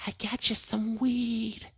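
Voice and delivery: put-on high, slow, secretive old lady. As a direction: lean in and slowly offer it like a sweet granny. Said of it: "She says".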